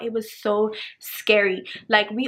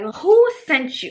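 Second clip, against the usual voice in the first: loud, slow, higher 'who sent you'; exasperated outburst; 5 semitones higher, 6 dB louder, slower than usual. Retold or imitated. Storyteller demanding who sent this man, exasperated outburst. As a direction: drag it out in loud disbelief.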